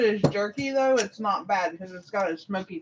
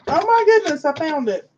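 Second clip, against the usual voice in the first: excited; 7 semitones higher, 7 dB louder than usual. Real, not acted. Excited at finding the flavor.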